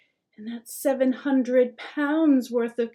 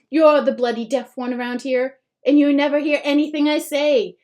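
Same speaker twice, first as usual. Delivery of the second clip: angry rant; 4 semitones higher, 8 dB louder than usual. A narrator's reading. Mom exploding at son.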